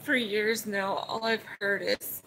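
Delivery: fighting back tears while telling about mom's call; choked up, emotional. Real, not acted. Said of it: "I'm sorry if I get emotional".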